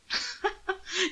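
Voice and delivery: high, soft amused chuckle; soft laugh. Acted. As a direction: laugh quietly at his naivety. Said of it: "Anthony laughed softly".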